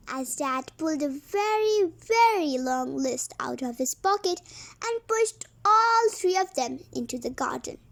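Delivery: ordinary delivery, nothing marked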